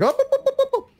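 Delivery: high rising oh oh oh; gleeful imitation of catching a speeder; mock excited. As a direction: squeal like spotting a speeder. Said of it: "I'm like".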